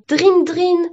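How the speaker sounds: loud, high "drin drin"; ringing sound effect; imitated ringing. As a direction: mimic a bell with the voice. Imitates a doorbell or phone ringing.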